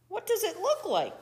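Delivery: high-pitched coyote voice; anxious question. A narrator's reading. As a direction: ask it high and worried. Worried Coyote asks from outside.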